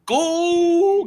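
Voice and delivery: Goal held long and high, like a stadium call; drawn-out goal. Shown as a demonstration. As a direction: sing out Goal on one long high note. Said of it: "Can you say goal?".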